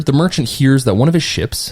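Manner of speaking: ordinary talk, nothing marked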